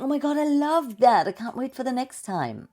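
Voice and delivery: high, loud gush of delight; excited. Acted. Speaker voicing the thought after loving the stage, excited. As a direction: burst out thrilled, pitch way up. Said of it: "and start thinking".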